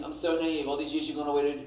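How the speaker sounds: ordinary delivery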